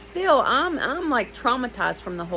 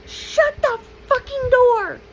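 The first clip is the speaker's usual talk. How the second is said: high, loud furious yell at the doors; humiliated shout; 7 dB louder than usual. Retold or imitated. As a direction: scream it to get them out. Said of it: "I shout".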